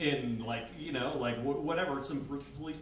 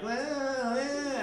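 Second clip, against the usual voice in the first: put-on much higher, drawn-out drunk-girl voice; mock drunk party girl; 11 semitones higher than usual. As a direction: ham up a tipsy girl. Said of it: "they're like".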